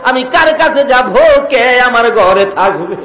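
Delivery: high, loud chanted lament; dramatized weeping plea. Acted. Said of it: "Amina কান্দে".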